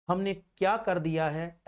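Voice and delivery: normal talking voice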